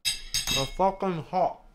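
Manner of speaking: drawn out, mouth full of hot food; slow pained drawl. Real, not acted. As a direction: stretch it slowly through the burn. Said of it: "I'm burning my fucking mouth".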